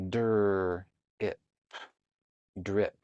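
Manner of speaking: segmenting "drip" into dur, it, p, then blending; sounding out drip. Shown as a demonstration. Teacher modeling how to sound out "drip" for a child. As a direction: pull apart the sounds of "drip" slowly. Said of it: "This one has the dur blender".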